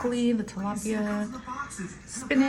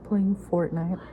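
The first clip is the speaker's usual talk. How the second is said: ordinary talk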